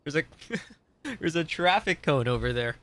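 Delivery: laughing through the words. Real, not acted. Streamer amused by a cone in the game, laughing. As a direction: giggle while pointing it out.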